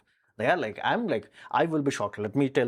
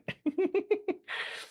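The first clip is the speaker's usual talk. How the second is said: high giggle. Real, not acted. Laughs at his own joke.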